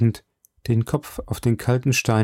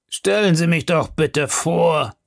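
slow, higher, fawning request; eager grub-man; 7 semitones higher than usual. Acted. Grub-man to the lawyer, begging an introduction, slow and fawning. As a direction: ask to be introduced with exaggerated manners. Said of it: "sagte der Essensmann".

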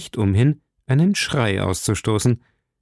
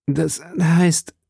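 halting, higher-pitched evasion; stammering; 7 semitones higher than usual. A narrator's reading. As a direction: stall for words, caught off guard. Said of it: "stammelte Milady". (stammelnd, leicht verlegen)

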